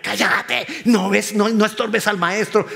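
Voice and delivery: high, sharp mock scolding; silencing someone. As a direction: hush a nuisance impatiently. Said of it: "lo acababan de callar".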